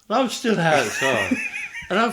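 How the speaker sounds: can't keep it together at the memory; giggling through the punchline; laughing. Real, not acted.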